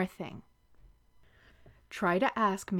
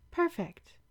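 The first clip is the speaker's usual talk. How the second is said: ordinary talk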